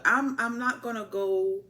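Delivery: ordinary talk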